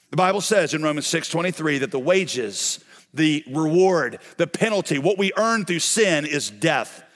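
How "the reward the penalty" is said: ordinary talk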